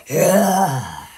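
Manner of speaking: low, growly lion roar. Acted.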